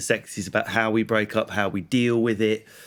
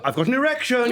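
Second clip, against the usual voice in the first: mock blurted confession; 11 semitones higher than usual. Acted blurt in Tesco's.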